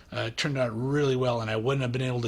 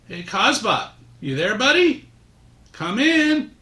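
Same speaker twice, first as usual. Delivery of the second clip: raised voice calling over a radio; 7 semitones higher, 5 dB louder than usual. Acted. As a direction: hail the satellite loudly.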